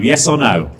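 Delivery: ordinary talk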